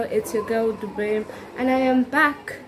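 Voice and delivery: ordinary delivery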